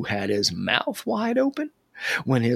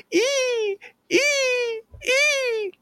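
very high, squealing shrieks; baby bird scream; much slower than usual. A narrator's reading. Baby bird dragged by frog's tongue, very high screams. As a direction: squeal like a tiny terrified bird. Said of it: "The baby bird screamed".

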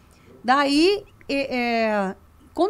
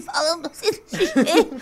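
mock choked voice, half laughing; lingering choke; 4 semitones higher than usual. Shown as a demonstration. Woman showing how she kept chatting after choking, mock strangled voice. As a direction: talk as if something is still stuck in the throat. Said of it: "você engasga e ainda fica um pouco".

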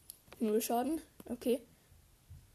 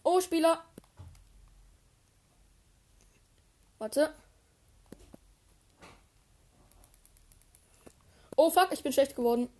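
panicking gamer; 5 semitones higher, 4 dB louder than usual. Real, not acted. Enemy player appears.

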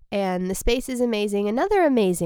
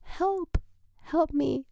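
mock feeble cry for help; 8 semitones higher, 4 dB quieter, slower than usual. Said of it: "And I'm like".

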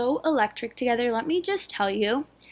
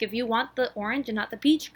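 ordinary delivery

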